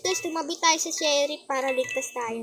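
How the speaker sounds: usual speaking voice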